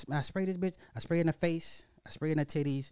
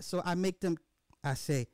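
ordinary talk

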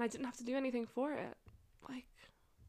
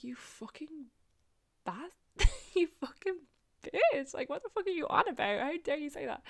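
high, indignant retold outburst; hammed-up indignation breaking with a laugh; mock outrage; 7 semitones higher, 3 dB louder than usual. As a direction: play up the insulted retort, pitched well up. Retold outraged retort to his insult.

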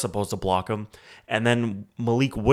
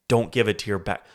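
normal talking voice

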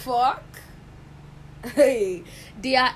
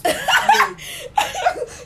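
big high laugh; 5 dB louder than usual. Real, not acted. Laughing out loud at the Solomon picture. Friend's joke about King Solomon.

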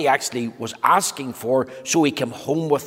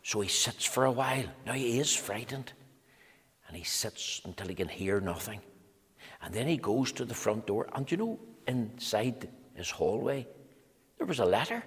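much softer, hushed narration; hushed telling; 8 dB quieter than usual. A narrator's reading. Narrator hushing the children as Quiet hides, quiet. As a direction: draw the children in, softly. Frightened hush in story.

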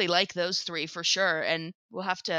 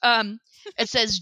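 ordinary delivery

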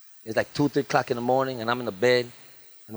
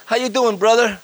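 mock greeting; 7 semitones higher, 4 dB louder than usual. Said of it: "I hate that when they do that".

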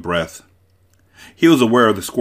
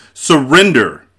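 loud, higher shouted demand; 7 semitones higher, 9 dB louder, slower than usual. A narrator's reading. Prince demands that Wesley give up, shouted. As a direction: shout it as an order. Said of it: "the prince shouted".

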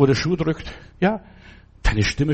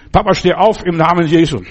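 louder, emphatic retold command of faith; imitated commanding prayer; 3 semitones higher, 7 dB louder than usual. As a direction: proclaim it loudly, as children praying over their father. Children command sick father in prayer.

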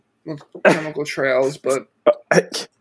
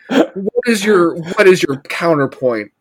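mock-furious demand; 5 semitones higher, 6 dB louder than usual. Real, not acted.

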